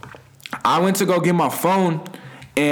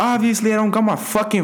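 higher-pitched, irritated comeback; exasperated retort; 6 semitones higher than usual. Retold or imitated. Narrator retorting to nosy partygoers, exasperated. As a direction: snap back in exasperation.

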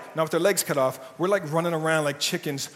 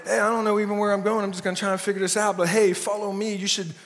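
lost clueless leader; 4 semitones higher, faster than usual. Retold or imitated. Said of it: "we're just like".

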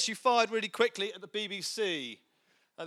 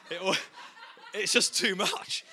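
laughing; 3 semitones higher than usual. Real, not acted. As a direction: laugh through the punchline. Punchline of his own joke.

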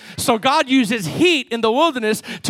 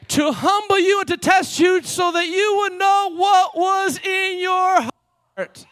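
higher, building preaching read; rising preaching; 8 semitones higher, slower than usual. Real, not acted. Pastor reading Deuteronomy to his church, building up. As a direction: climb toward the word heart. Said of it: "preaching the verse".

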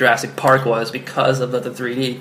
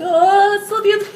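squeaky falsetto gushing, much higher; gushing mock voice. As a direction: gush like an awestruck moviegoer. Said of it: "So you're like".

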